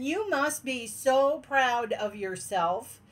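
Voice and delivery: normal talking voice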